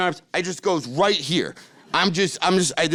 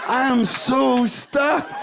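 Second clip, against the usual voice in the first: slow, helpless whine; 6 semitones higher, slower than usual. Retold or imitated. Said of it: "I'm like".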